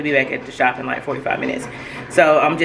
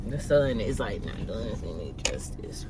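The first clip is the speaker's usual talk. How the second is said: whisper; 7 semitones lower, 12 dB quieter than usual. Real, not acted. Aside about the sun.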